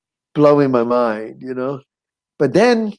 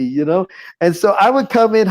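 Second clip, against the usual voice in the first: ordinary talk, nothing marked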